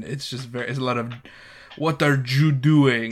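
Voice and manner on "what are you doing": slow, cartoonish mock Mexican accent; imitating a cartoon Mexican accent. Host mimicking Tucci's overdone Mexican accent, slow and cartoonish. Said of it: "but Tucci doing Mexican".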